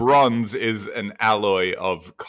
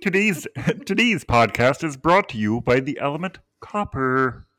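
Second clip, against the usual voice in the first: ad read voice; 4 semitones higher, 3 dB louder than usual. Pretend. Mock sponsor read.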